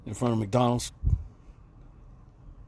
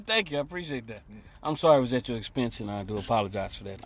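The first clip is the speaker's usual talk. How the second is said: ordinary talk, nothing marked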